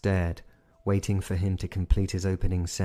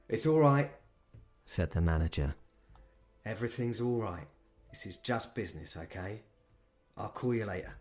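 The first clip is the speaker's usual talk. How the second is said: put-on soft, calming London accent; reassuring London manager; 3 dB quieter than usual. Manager calming his friend, gentle London accent. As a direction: settle your mate down. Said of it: "He had a London accent".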